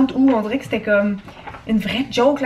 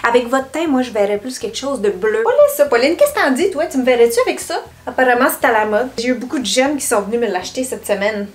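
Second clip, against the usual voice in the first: put-on lightly done 1960s Québécois shop clerk accent, rolled R; a hint of the old Radio-Canada 1960s accent, playing a saleswoman; 1960s Quebec accent. Shop clerk greeting customers in a light 1960s Québécois accent. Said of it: "prendre l'accent des années soixante".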